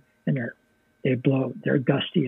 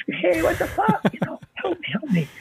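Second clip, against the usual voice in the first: high mock yell after the fire truck; mock frantic call for help; retold yell. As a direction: call after the truck, much higher, half laughing. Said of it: "and I'm yelling".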